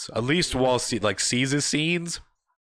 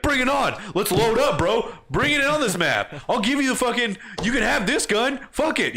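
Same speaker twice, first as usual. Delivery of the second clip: hyped taunt; 10 semitones higher than usual. Retold or imitated. Gamer taunting before the match, hyped mock challenge. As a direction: egg them on with swagger.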